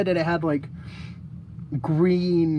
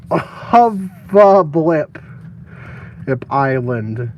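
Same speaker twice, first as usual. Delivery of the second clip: slow; 5 semitones lower, 6 dB louder, much slower than usual. Real, not acted. Typing the name slowly.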